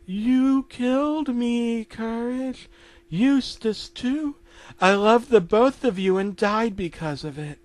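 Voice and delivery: high ghostly reproach; Muriel accusing. Acted. Dead Muriel blaming her dog for her death, ghostly. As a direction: begin very slow and high, then reproach.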